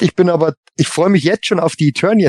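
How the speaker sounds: usual speaking voice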